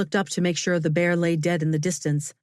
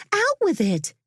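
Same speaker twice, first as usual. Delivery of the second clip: impatient friend. A narrator's reading. Impatient friend demands news.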